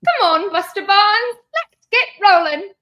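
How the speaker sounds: high, loud mock English accent; hammed-up old-time English tea-shop customer. Host playing a tea-shop customer to Buster, loud mock English accent. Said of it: "tea and crumpet place back in".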